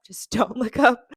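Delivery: giggling self-reminder to stay calm; laughing self-pep talk. Retold or imitated. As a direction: coach yourself with a nervous laugh. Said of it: "just told myself".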